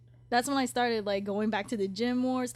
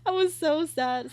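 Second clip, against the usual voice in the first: laughing into tears; 7 semitones higher, 9 dB louder than usual. Real, not acted. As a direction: laugh breaking into real crying, higher and louder. Laughing about dad, then crying.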